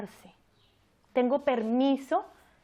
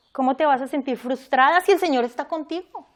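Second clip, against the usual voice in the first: mock scolding; 3 semitones higher, 7 dB louder than usual. Mocking judgmental churchgoer's reproach.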